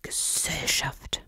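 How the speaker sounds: a slow, whispered grumble; grouchy badger muttering; grumbling badger. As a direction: scoff at company under your breath. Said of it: "brummte dann".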